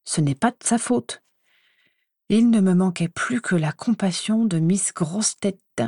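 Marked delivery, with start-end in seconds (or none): 0.0-1.2 a touch of reproach, a bit higher; mild reproachful defence. Acted. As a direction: chide him gently for blaming her. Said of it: "d'un air de reproche".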